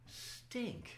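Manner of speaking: high, very soft mock letdown; comic disappointment. Patient realising he will live, comic disappointment. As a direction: squeeze it out small and high, a private grumble.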